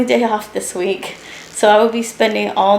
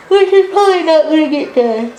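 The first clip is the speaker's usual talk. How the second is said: yawning; 8 semitones higher, 5 dB louder than usual. Real, not acted. Yawning worry over sewing deadline.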